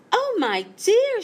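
high and bright with delight; gleaming. A narrator's reading. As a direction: beam out oh my dear. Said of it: "she gleamed".

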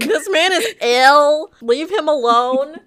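high, loud, slow mock cry; playful shouted echo; mock yell. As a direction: yell it in a hammed-up panic. Echoing the scream, mocking.

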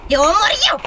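loud, high playful threat; mock threat shout. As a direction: bark it out like a cheeky bandit. Robber girl threatens jokingly. (strigăt amenințător în glumă)

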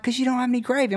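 high mock voice; mimicking the hosts. The promoters scolding him about gravy, high mocking voice. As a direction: squeak it out like the fussy hosts. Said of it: "they're like".

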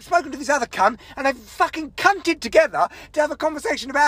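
high-pitched, indignant tirade; exasperated, sweary outburst; angry rant. Real, not acted. Ranting about the yogurt complaint.

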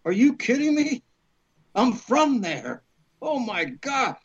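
can't believe his luck, voice jumping up; retold excited disbelief. To his agent about Mobile, excited disbelief. Learns the film shoots in his hometown.

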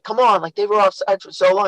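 mock the rival coach with a high complaint; whiny other coach. Coach mocks the opposing coach complaining about offsides, high whine. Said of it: "the other coach is like".

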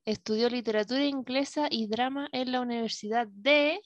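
normal talking voice

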